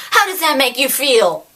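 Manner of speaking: play-acted telling-off; mock reprimand. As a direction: scold the offender in a put-on way. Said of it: "like, reprimanded the Salarian the same way".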